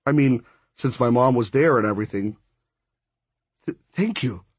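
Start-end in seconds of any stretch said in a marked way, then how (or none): 3.7-4.4 stuttering. A narrator's reading. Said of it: "Mom stuttered".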